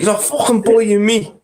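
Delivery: high-pitched mock Scouse; imitating a Scouser, pitched right up; Scouse accent. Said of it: "That's quite a good Scouse accent".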